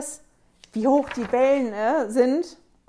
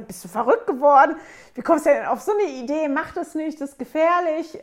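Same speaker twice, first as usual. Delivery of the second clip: higher-pitched imitated protest; alarmed disbelief; 5 semitones higher, 5 dB louder than usual. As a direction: mimic disciples warning a reckless friend. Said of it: "In meiner Vorstellung waren die eher so". (entsetzt abratend nachgespielt)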